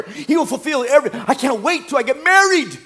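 mock a giddy would-be bride, pitch soaring; gushing excitement. Single woman dreaming of marriage, gushing excitedly.

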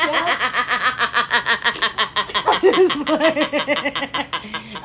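long helpless laughing fit. Real, not acted. Co-host at the crude joke, long laughing fit. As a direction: crack up and keep laughing.

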